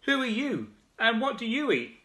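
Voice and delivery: high calling shout; frog shouting. Acted. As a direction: call out to the koala. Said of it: "shouted the big wide-mouthed frog".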